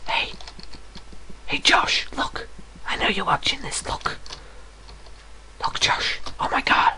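soft conspiratorial whisper; whisper to Josh. Acted. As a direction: lean in and whisper to your friend. Whispering to friend watching.

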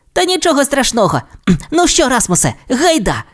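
put-on high-pitched carefree kid; cheery young dinosaur. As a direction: shrug it off and rally your friend. Timmy shrugs it off, calls onward.

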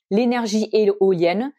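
usual speaking voice

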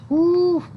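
high whoo of relief; mock relief. Leader relieved the frog is gone, high whoo. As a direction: breathe out like you escaped.

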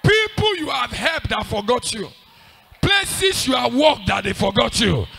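loud, high declaring shout; full-force preaching. Real, not acted. Preacher declaring remembrance to the congregation, shouting.

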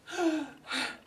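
a sharp, high, overacted gasp of shock; acted gasp. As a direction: gasp as if finding a body. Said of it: "Sorry for the overacting".

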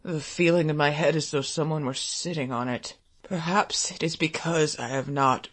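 low, soft muttering with throat-clearing and long pauses. A narrator's reading. A hungry man with a cold blames himself, muttering. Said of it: "he was muttering".

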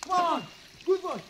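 squeaky coaxing a dog. Real, not acted. (suara cempreng membujuk anjing)

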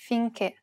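"finché" ending in a stressed closed e; careful teaching "finché" with closed last vowel. Shown as a demonstration. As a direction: keep the final é of "finché" closed. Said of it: "pronounce it as a closed E".

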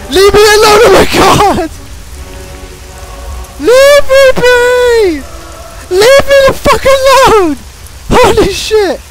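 high, wailing exasperation; exasperated yelling. Real, not acted. Gamer to the dog that keeps attacking, exasperated. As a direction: cry out, pestered beyond patience.

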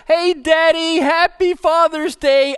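put-on high, loud burst of a kid's greeting; excited little boy. Little boy bursting into his dad's room, excited shout. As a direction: call out to Daddy, high and thrilled.

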